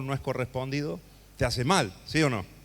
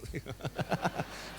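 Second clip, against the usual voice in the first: soft airy chuckle with no voice; breathy silent laugh; 9 dB quieter than usual. Real, not acted. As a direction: laugh under your breath. Laugh at own joke.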